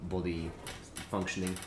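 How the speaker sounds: chewing while talking; talking through a mouthful; mouth full. Real, not acted. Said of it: "Sorry for eating my mouthful".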